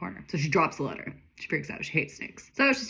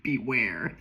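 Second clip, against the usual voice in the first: ominous beware; 6 semitones lower than usual. Acted. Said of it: "in seventy-two Helvetica font".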